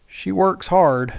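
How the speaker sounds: anxious father bargaining, drawn out and high; stammering plea. A narrator's reading. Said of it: "her father stammered".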